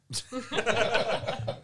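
loud high laugh; real laugh. Real, not acted. Laugh after story ends.

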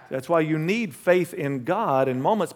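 ordinary delivery

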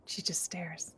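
soft unvoiced narration; whisper. A narrator's reading. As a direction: breathe the line, barely there. GM narrates ghost staring.

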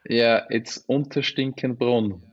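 Unterstinkenbrunn said slowly with a native Austrian German rolled R; slow, careful Unterstinkenbrunn in German sounds; Austrian pronunciation. Shown as a demonstration. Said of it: "pronounce your hometown".

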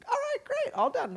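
bright high "All right, great"; cheery dad. Retold or imitated. Dad cheerfully welcoming the toddler back. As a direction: praise the kid happily.

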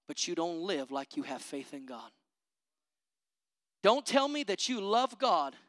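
normal talking voice

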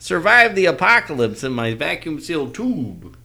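high, loud pitchman voice; infomercial. Pretend. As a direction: sell it like a late-night TV pitch. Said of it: "the My Pillow guy should be doing an infomercial".

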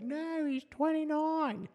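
put-on high, slow creaky old man. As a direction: quaver like a grumpy old man. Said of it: "It's just kind of old man voice".

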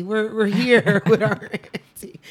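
amused mock kid line, broken by laughter; laughing imitation. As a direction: imitate the kids while cracking up. Voices the kids while laughing.

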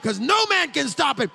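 high, loud, roaring sermon cadence; preaching at full force. Acted. Preacher thundering the Bible story to his church, full force. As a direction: pound the story out to the congregation.

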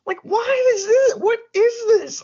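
very high, exasperated disbelief; incredulous outburst. Real, not acted. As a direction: let the questions leap up in baffled protest. Incredulous rant about vaccine objectors.

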